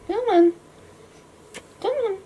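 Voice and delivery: high coaxing call to a cat; coaxing pet voice. Real, not acted. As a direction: sweet-talk the cat over. Coaxing her cat to come.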